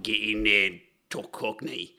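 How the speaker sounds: low mock Cockney; half-hearted Cockney, down in pitch; Cockney accent. Shown as a demonstration. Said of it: "you could have had Cockney".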